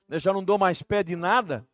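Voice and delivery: loud, high preaching build; preaching at full force. Acted. Pastor telling his church the story, preaching loudly.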